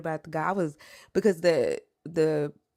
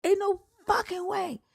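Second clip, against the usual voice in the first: disbelief; 14 semitones higher than usual. Retold or imitated. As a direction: refuse to believe it, wailing. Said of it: "I'm like".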